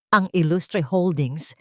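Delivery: usual speaking voice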